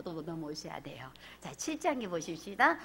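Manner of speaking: hushed, secretive whisper; soft low whisper to the congregation. Real, not acted. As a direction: lean in and whisper. Preacher drops to whisper.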